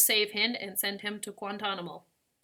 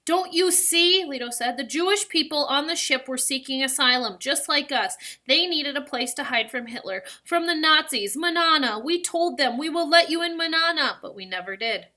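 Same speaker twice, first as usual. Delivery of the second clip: higher, louder, distraught pleading; agitated old grandfather's confession; 5 semitones higher, 5 dB louder than usual. A narrator's reading. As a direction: pour it out in anguish. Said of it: "Lito was now crying, distraught".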